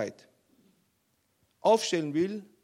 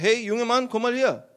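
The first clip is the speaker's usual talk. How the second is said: loud, raised summons; calling someone over; 4 semitones higher, 7 dB louder than usual. Acted. General summoning his king, calling out loudly. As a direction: call him over firmly.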